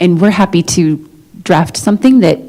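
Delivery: ordinary talk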